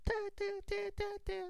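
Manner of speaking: very high tiny squeaking te te; timid rabbit squeak. Acted. Rabbit creeping back to the rat's shop, squeaking. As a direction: squeak like a nervous little rabbit tiptoeing back.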